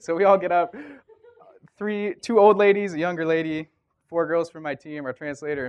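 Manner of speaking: high, loud animated telling; amused and loud. Real, not acted. Telling who got up to dance, loud amusement. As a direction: belt it out, tickled by the story. Amused at own dancing story.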